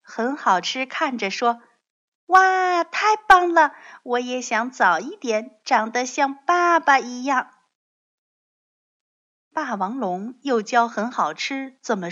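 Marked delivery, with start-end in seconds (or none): 2.3-7.5 put-on high, loud child's wow; excited admiration. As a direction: cheer daddy on as a thrilled little one. Baby admires dad's skill.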